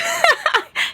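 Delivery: high-pitched burst of laughter. Real, not acted. Laughing as she tells of the slap, high giggle. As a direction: crack up at your own story.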